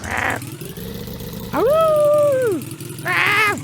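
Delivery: loud high yelps of alarm; screaming while falling. Real, not acted. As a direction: cry out as the car slides. Car slips back into water.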